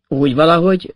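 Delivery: ordinary delivery, nothing marked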